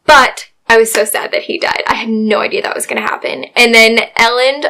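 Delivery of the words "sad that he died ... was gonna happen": fast breathless whisper; a quick stunned whisper. Real, not acted. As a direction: hush it like a secret. Whispered aside.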